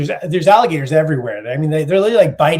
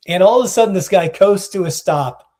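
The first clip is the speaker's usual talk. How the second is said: ordinary delivery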